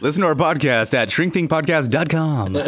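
high, hammed-up announcer plug; co-host parodying a commercial in a high announcer voice; mock ad read. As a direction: sell it like a cheesy radio spot. Mock ad plug after partner's.

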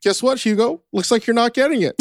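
mock teasing. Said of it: "I was like".

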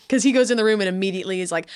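normal talking voice

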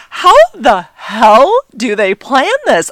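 loud, high, slow incredulity; amazed disbelief. Retold or imitated. Fan reliving the perfectly timed flyover, astonished.

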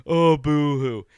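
loud, slow mock wail; fake crying. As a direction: whine boo-hoo like a crybaby. Mocking critics of violence.

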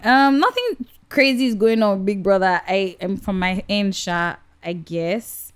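ordinary delivery

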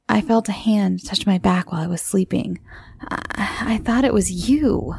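usual speaking voice

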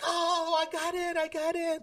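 high, thrilled mock yell; excited yelling. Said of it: "I started yelling and screaming".

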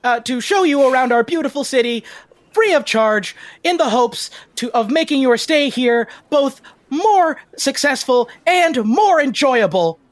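high, emphatic welcome speech; eager tour guide. Acted. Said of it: "he said".

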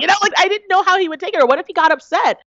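nervous high chatter; high anxious. Real, not acted. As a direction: rush it out, voice jumping up. Anxious retelling of fear.

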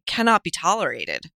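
ordinary talk

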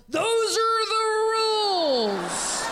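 high, slow, loud group yell; crowd yelling. Retold or imitated. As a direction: bellow it like a rowdy room insisting. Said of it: "The whole room yells".

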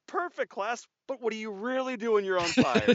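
high, bright teacher voice quizzing; playful teacher addressing a class. Retold or imitated. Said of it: "And then I said".

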